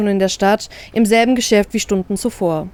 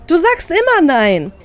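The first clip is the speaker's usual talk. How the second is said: high, slow sulky growl on immer; stamping-foot frustration, a touch angry; 8 semitones higher than usual. Acted. Sister snaps at her brother who keeps refusing, mock angry. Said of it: "knurre ich wütend".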